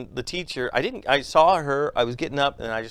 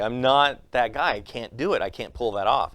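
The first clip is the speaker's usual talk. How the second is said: ordinary delivery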